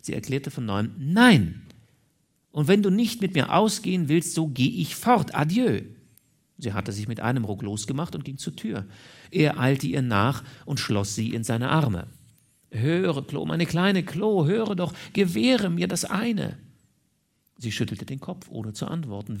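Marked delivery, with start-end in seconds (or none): 1.0-5.8 loud, high-pitched ultimatum; raised, forceful indignation; defiant refusal. A narrator's reading. Woman refuses again, threatens to leave. 12.7-16.6 slow plea climbing in pitch; drawn-out, high beseeching; tender imploring. A narrator's reading. Man implores woman not to leave.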